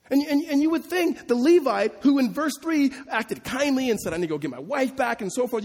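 high, loud and driving, pressing the point hard; impassioned preaching. Acted. Preacher challenging the congregation about the old man, preaching at full force.

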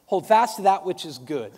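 loud, punching HOLD FAST; loud emphatic. Shown as a demonstration. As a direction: proclaim it loudly to the room. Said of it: "Hold fast literally means".

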